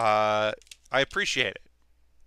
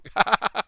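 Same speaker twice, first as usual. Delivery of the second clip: short burst of chuckles, pitched up; amused laugh; 6 semitones higher than usual. Real, not acted. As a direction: laugh at your own mistake. Laughs at own mistake.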